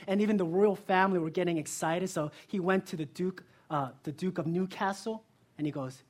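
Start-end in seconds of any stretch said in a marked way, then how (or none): none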